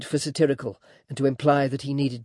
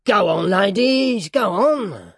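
high, loud, drawn-out character voice; slow, pushy dwarf's call; leering dwarf, goading; 7 semitones higher, 5 dB louder, slower than usual. A narrator's reading. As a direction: egg them on with mocking relish. Voices dwarf Quilp in reading.

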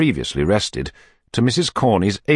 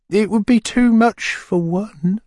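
murmuring widow; 4 dB louder, slower than usual. A narrator's reading. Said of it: "murmured the lady".

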